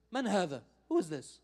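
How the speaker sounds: retold gruff demand, clipped question; mild mock-gruff challenge. Al-Qa'nabi questioning the people around the scholar, mildly gruff. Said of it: "in a very gruff way".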